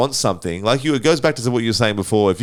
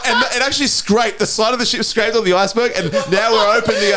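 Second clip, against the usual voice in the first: laughing; 10 semitones higher than usual. Pretend. Mock captain story, laughing.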